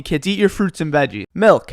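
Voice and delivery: normal talking voice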